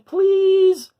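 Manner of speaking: high dinosaur voice, begging; pleading little dinosaur. Acted. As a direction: coax them to take the toys, trailing off. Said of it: "tried saying".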